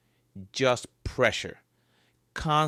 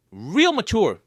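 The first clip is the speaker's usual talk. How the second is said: sarcastic; 8 semitones higher, 7 dB louder than usual. Acted. Sarcastic quip at bleeding walls.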